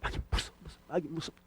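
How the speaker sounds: hushed, mock-frightened murmur; very soft pretend dread; acted fear. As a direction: play scared of the devil under your breath. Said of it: "마귀를 너무 두려워하는 거죠".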